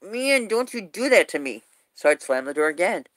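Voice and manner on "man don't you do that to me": high-pitched retold protest, mildly exasperated; mock exasperation.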